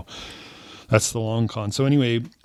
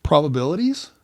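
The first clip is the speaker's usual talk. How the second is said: higher, put-on innocent voice; mock explaining; 6 semitones higher than usual. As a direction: explain with feigned innocence, pitch raised. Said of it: "I say".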